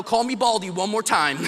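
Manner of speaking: playfully menacing preacher; joking threat. Retold or imitated. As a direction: warn them with a laugh breaking in. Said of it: "that threatening type of demeanor".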